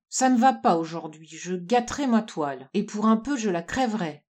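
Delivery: plain reading voice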